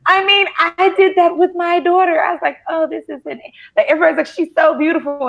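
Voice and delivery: high, loud animated retelling; excited retelling. Retold or imitated. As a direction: squeal it out, very high. Retelling reaction to her baby.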